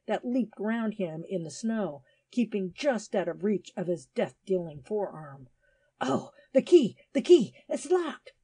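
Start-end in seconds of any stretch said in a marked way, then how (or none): 6.0-8.3 high, loud cry of alarm; acted desperation, loud and high; desperate cry. A narrator's reading. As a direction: cry out desperately, pitched right up. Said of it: "cried Nell rather desperately".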